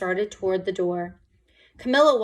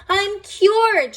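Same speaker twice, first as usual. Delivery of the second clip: very high, loud happy shout; joyful shout; 11 semitones higher, 8 dB louder than usual. A narrator's reading. Camilla celebrating her cure, joyful shout. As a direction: shout it with joy. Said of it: "she shouted".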